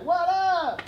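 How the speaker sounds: high-pitched "what up"; playful greeting. Retold or imitated. Answering a supposed prank call, high playful greeting. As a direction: toss out a high, jokey phone hello.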